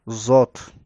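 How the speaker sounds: loud "زات" with tongue against the upper palate; heavy, isolated z on "زات"; emphatic Z. Shown as a demonstration. Teacher repeating the letter for learners, emphatic "زات".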